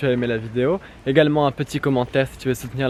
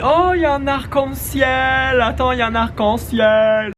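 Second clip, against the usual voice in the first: high, slow gasp of delight at a rainbow; excited; 9 semitones higher than usual. Real, not acted. Vlogger spotting a rainbow, calling to friends, thrilled.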